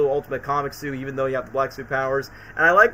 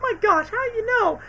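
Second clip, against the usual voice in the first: much higher flustered squeak; scientist. Retold or imitated. Lab assistant caught by Spider-Man, high squeak. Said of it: "she's like".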